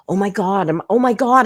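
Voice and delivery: exasperated outburst at the streamer; exasperated oh my God. Real, not acted. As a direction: throw up your hands in disbelief. Exasperated at her gamer pretence.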